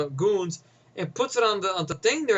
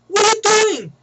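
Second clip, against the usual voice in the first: high, loud shout of disbelief; outraged mock yell; 14 semitones higher, 8 dB louder than usual. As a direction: burst out as if catching a partner misbehaving. Acted outraged man confronting woman.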